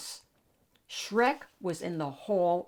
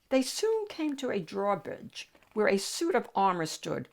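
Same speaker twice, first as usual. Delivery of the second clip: ordinary delivery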